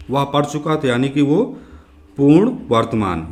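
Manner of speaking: ordinary talk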